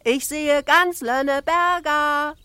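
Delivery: very high, drawn-out loud call; little girl shouting down. A narrator's reading. Little Ida boasts of her view from the flagpole, shouting down. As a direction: sing it out slowly and loudly from up high. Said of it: "schrie sie nach unten".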